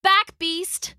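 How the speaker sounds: high, fierce battle cry; forceful order, pitched well up; cried command. A narrator's reading. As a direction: cry out to drive the beast back. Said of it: "Lydia cried".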